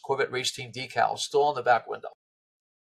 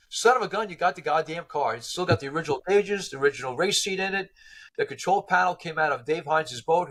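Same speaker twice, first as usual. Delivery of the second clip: excited retold caller, pitched higher; blown away; 5 semitones higher than usual. Old race-team member phoning about the found car, blown away. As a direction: marvel at it in a raised voice. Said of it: "they were blown away".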